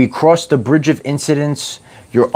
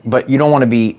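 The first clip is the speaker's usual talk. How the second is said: normal talking voice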